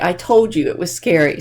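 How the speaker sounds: usual speaking voice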